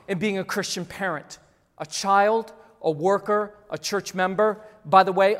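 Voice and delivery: ordinary delivery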